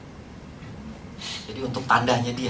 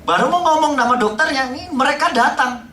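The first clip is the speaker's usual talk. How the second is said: ordinary delivery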